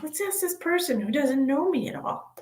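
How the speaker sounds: a hint of a naive asker; teasing the viewer's thought in a mock voice. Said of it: "And you think".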